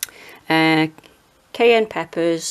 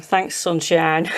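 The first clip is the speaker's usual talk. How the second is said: ordinary talk, nothing marked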